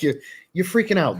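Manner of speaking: normal talking voice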